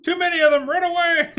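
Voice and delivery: panicky squeal. Acted. Cowardly actor fleeing the fight, squealing. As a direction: squeak it out as you run. Soldier flees in play.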